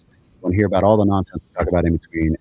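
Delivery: ordinary talk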